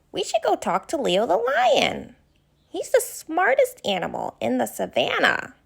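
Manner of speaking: put-on a kind, worried young giraffe asking questions; Ginger the giraffe. Ginger has an idea.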